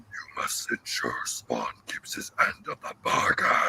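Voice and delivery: slow, deep whispered demon; demon lord voice. Retold or imitated. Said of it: "the Demon Lord saying".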